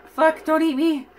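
high, loud yelp of alarm; alarmed outburst. Real, not acted. Player to the creature attacking them, alarmed shout. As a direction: cry out, suddenly under attack.

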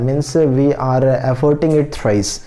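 ordinary talk, nothing marked

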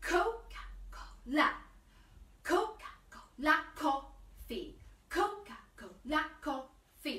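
measured chant of "Coca-Cola", hitting "Co" and "la"; accented first and last syllables. Shown as a demonstration. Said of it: "accents on the first bit of the word and the last".

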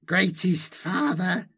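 high, slow inhuman supplicant; high distorted creature voice; Malindi, hissed. A narrator's reading. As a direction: hiss a drawn-out plea in a high alien voice. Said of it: "she hissed".

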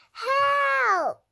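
high, loud call; cry for help. Acted. Trapped Octopus calling friends for help. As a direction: shout for help, tangled up.

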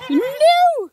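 high loud squeal. Real, not acted. Goats grab her fingers, she squeals no.

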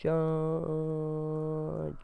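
drawn-out battle cry. Pretend. Cha-, oh, right.

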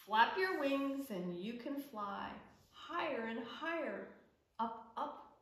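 lively picture-book reading, calls of yes and hooray up high; reading to children. Acted. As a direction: read it to little ones at story time. Reads picture book aloud.

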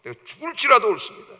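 indignant, acted retort; acted anger. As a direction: Jonah insisting angrily before God. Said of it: "하나님 앞에 막 이렇게".

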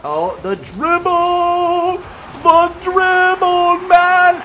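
loud, high, stretched-out cheer; excited shout. Real, not acted. Gamer hyping his own dribble, drawn-out excited shout. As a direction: savor the move.

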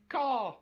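high imitated crow call; sudden high-pitched squawk; bird caw.

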